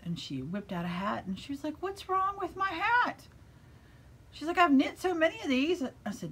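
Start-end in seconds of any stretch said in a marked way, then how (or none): 1.8-3.3 high-pitched mock plaint; complaining student. Student showing teacher a failed hat, mock complaint. Acts out upset student's question. 4.6-5.8 high, loud mock protest; protesting student. Student defending her knitting to teacher, mock protest.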